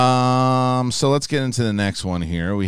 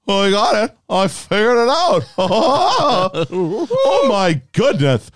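Mad Hatter; 9 semitones higher, 6 dB louder than usual. Acted. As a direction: play a gleeful storybook madman, pitched way up. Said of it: "It's the Mad Hatter".

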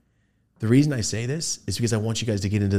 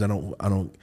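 normal talking voice

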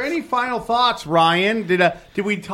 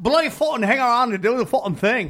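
higher, hammed-up Irish accent; bad Irish accent; 4 semitones higher than usual. Shown as a demonstration. Asked for a seventies Cork voice, hammed-up Irish accent. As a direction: put on a rough mock Irish accent. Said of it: "does an amazing Irish accent".

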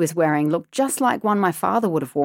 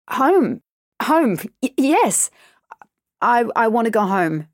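higher, louder, stammering with fear; scared stammer; 4 semitones higher, 4 dB louder than usual. A narrator's reading. Girl in the stranger's car, stammering in fear. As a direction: blurt it out, panicked and stuttering.

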